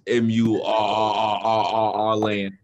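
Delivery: MURRRR-land, the R held very long; mocking drawn-out R. Teasing the other on how they say Maryland, mocking drawn-out R. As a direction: spell out M U, then drag the R into land. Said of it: "Y'all say Merlin".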